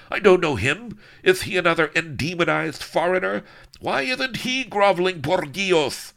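high, loud outraged ranting; raging tyrant. A narrator's reading. Don Lardo raging at Enchilada about Avery, high loud rant. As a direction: rage at the stranger who won't bow.

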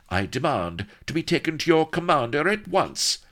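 high, insistent ladylike demand; imperious noblewoman. Acted. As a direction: demand it as one born to command. Haughty noblewoman makes a demand.

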